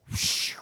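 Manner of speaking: long, airy whoosh noise; voiceless swish of air; imitated whoosh. Shown as a demonstration. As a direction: blow out a rushing whoosh. Imitates words flying overhead.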